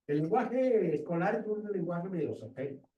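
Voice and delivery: ordinary talk, nothing marked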